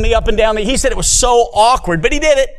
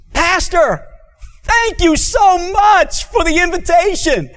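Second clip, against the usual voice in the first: excited woman voice; 10 semitones higher, slower than usual. Retold or imitated. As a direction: call it out high like a thrilled churchgoer. Lady thanks pastor, voiced.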